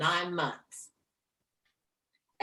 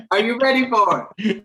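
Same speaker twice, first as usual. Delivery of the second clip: louder, eager host question; TV host asking; 3 semitones higher, 9 dB louder than usual. Retold or imitated. The TV host putting the mic in her face, louder. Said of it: "like, sitting".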